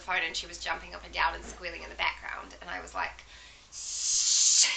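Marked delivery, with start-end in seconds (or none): 3.7-4.7 unvoiced hushing hiss; urgent shush. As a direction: hush someone nearby quickly. Hushing mum on phone.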